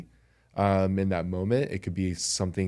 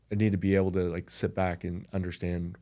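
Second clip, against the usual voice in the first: ordinary talk, nothing marked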